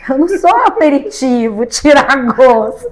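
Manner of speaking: loud laughing comeback; laughing retort. Retold or imitated. Quoting her reply to the man, laughing loudly. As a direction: laugh through the punchline. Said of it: "virei e falei".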